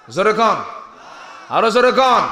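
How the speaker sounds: loud, high call to the crowd; rallying shout. Real, not acted. Preacher urging the crowd to answer, rallying shout. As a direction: shout to rouse the congregation.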